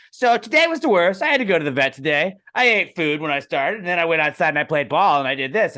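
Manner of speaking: playful dog voice for a TikTok; dog narrator voice. Retold or imitated. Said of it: "narrate a day in the life of their dog".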